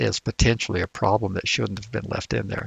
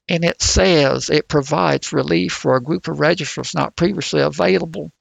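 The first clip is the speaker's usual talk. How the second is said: the same line again in a put-on West Virginia coal miner accent, higher; 4 semitones higher than usual. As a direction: imitate a West Virginia miner reading the analysis. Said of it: "trying to talk like a West Virginian".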